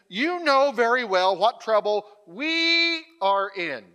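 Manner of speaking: loud reading with "we" held long and high; emphatic WE. Acted. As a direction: stretch and punch the word "we". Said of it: "Did you hear my emphasis there?".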